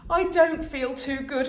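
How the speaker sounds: lightly voiced tired mother; a hint of a worn-out mum; Mrs Large, weary. A narrator's reading. Mum telling dad she feels unwell, tired voice.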